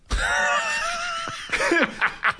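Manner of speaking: high, helpless burst of laughter. Real, not acted. Boxer laughs telling the host about the bear. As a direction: crack up at the memory.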